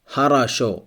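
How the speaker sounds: "хорошо" said ha-ra-SHO; unstressed O as A. Shown as a demonstration. Teacher models an example word for learners, "хорошо" as ha-ra-SHO. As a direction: say "хорошо" with both unstressed o's as a. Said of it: "it's pronounced like a".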